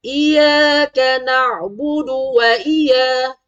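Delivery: "iyyaka" high and drawn out, y not pressed; mistaken recitation. Shown as a demonstration. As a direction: chant "iyyaka" with a light single y. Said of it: "Ah, bukan iyyaka". (baca "iyyaka" salah, sabdu tidak ditekan)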